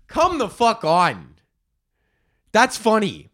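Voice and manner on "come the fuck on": ordinary delivery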